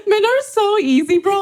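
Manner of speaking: high laughing voice. Real, not acted. Joking with a friend about men, laughing high.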